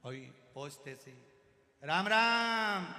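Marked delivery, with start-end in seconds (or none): 1.8-2.8 slow, very loud, drawn-out greeting; half-deaf old man booming his greeting at a patient; shouted greeting. Acted. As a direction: bellow it across the ward. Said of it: "चिल्ला के बोलता है".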